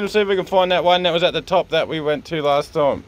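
ordinary delivery

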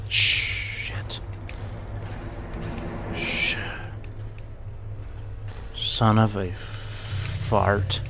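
very slow, each word dragged out; drawn-out curse. Acted. Gamer losing again, slow dragged-out mock curse. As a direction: stretch the mild curse out in defeat.

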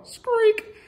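usual speaking voice

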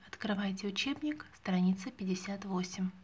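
usual speaking voice

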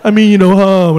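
low, loud, easy-going serpent; friendly serpent voice. Retold or imitated. Serpent telling him he's sound, low reassuring voice.

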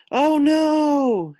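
high, loud cry of dismay. Acted. Friends hear Frog must leave, loud dismayed cry. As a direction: wail it in shock. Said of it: "his friends cried".

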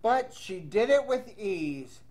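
lively read-aloud storytelling voice; reading to children. Acted. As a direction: read the rhyme to young kids. Teacher reads picture book aloud.

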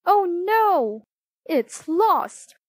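high, loud cry of dismay; acted alarm. A narrator's reading. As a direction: blurt it out in sudden panic. Man suddenly finds the ring lost.

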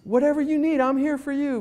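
idealized friendly phone agent, mock sugary; friendly helper. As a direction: sweet-talk the caller like a dream rep. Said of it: "I'd want him to say".

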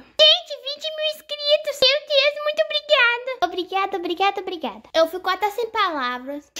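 put-on squealing high child voice; overjoyed little girl. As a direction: gush thanks like an overwhelmed child. Doll celebrating subscribers.

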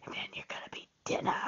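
fast sly whisper; a mock-sinister aside; whispered joke. Joking punchline about eating squirrel.

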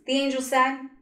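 ordinary delivery

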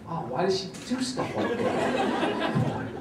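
slow, exasperated inner groan with a laugh; exasperated thought. Retold or imitated. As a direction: drag it out, half laughing in disbelief. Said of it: "Thinking like".

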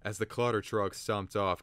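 usual speaking voice

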